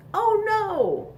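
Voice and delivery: high surprised cry; mock alarm for the kids. Another button pops off.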